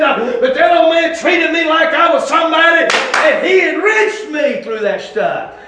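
high, impassioned preaching that keeps climbing; fervent shouted testimony from the pulpit; full-force preaching. Real, not acted. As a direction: drive each phrase harder toward a peak.

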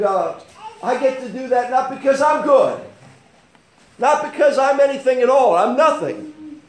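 high, loud testimony from the pulpit; impassioned preaching. Real, not acted. Preaches with raised voice.

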